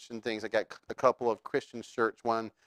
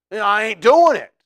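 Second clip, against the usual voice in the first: put-on loud, higher Southern accent, stubborn refusal; 9 semitones higher, 13 dB louder than usual. Preacher voices Peter refusing to deny Jesus, loud Southern accent. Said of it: "well, that's Southern".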